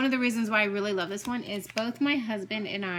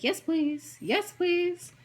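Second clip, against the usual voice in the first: higher, rising eager "yes please"; 8 semitones higher, much slower than usual. Retold or imitated. Shopper grabbing a discounted stitch-along, eagerly. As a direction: jump at the sale deal. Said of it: "I was like".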